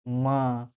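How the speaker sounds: माँ stretched on its final vowel; drawn-out vowel. Shown as a demonstration. Teacher showing the vowel sound inside माँ, drawn out. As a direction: hold the ending of माँ. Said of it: "last अ का उच्चारण आ रहा है".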